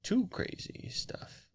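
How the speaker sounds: whispered. Real, not acted. Whispered echo.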